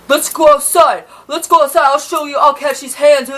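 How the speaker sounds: loud, high taunting yell; half-shouted retold threat; mock threat. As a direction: throw out the challenge to fight. Roommate's boyfriend threatening a fight.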